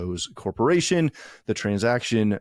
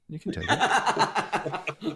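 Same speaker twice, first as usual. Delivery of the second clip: laughing; 9 semitones higher, much slower than usual. Real, not acted. Laughing at friend's joke.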